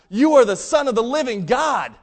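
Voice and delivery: loud, high, ringing declaration; very confident. Retold or imitated. Peter answers Jesus who he is, very confident. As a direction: proclaim it with total conviction. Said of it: "Peter's very confident in that".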